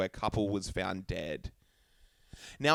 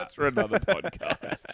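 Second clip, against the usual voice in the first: slow, higher teasing echo; mock solemn echo; 10 semitones higher, 4 dB louder than usual. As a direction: repeat it slow and ominous. Echoing partner's line mockingly.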